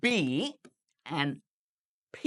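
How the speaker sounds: "B" and "P" both made with pressed lips; two-lip B and P. Shown as a demonstration. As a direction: demonstrate "B" and "P" from closed lips, separately. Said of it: "দুই ঠোঁট চাপ দিয়ে". ("B" আর "P" দুই ঠোঁট চাপ দিয়ে)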